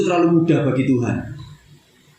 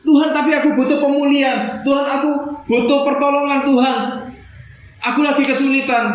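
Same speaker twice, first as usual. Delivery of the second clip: pleading prayer; 6 semitones higher than usual. Pretend. Believer begging God for help.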